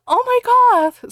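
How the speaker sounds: high, loud, slow mock shock; hammy gasp. Slughorn gasping as Voldemort flies off, hammed-up. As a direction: gasp it out like a soap actor. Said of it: "Slughorn gasped".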